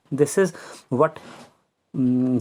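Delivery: ordinary talk, nothing marked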